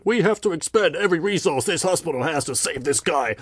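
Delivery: high, strained, overdramatic plea; mock-desperate, high-pitched proclamation; hammed-up urgent Dawn. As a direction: act out an officer's sudden urgent insistence. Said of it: "suddenly she's like".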